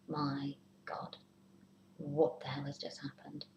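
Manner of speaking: hushed, breathless whisper of panic; panicked whisper. Pretend. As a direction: whisper it like a worried thought. Panicked inner voice of partner.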